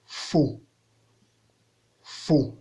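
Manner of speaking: faux short, dropping to a low tone. Shown as a demonstration. Teacher giving an example word, faux on low tone. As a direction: let faux sink low, twice.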